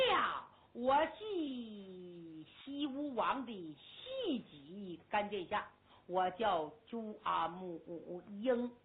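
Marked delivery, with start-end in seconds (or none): none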